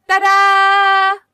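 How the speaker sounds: a high, loud, showy reveal; triumphant ta-da. Acted. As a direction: present it like a magic trick. Showing off something to sister.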